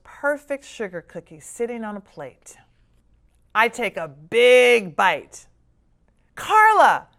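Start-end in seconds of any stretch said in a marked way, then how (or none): 6.3-7.1 high, loud yell of a name; child yelling a name. A narrator's reading. Kim yells at Carla for eating Santa's cookie, loud and alarmed. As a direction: yell to stop her sister. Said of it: "Kim yells".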